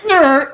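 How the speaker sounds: very high squeaky yelp of pain; pained whimper. Retold or imitated. Mimicking partner's yelp from the botched injection. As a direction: squeak it out like the needle hit bone. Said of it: "he made this noise that came out of his soul".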